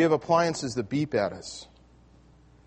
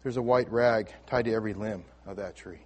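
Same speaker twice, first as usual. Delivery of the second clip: soft, gentle reveal, softer and lower; quietly gentle friend's answer; 5 semitones lower, 5 dB quieter than usual. Retold or imitated. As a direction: break the good news tenderly. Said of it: "in a very gentle voice".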